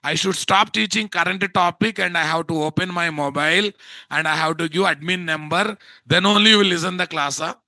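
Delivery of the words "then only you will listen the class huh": ordinary delivery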